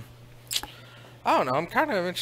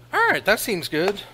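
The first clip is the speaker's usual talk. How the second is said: ordinary talk, nothing marked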